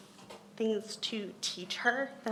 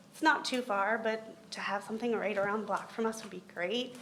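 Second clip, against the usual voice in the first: ordinary talk, nothing marked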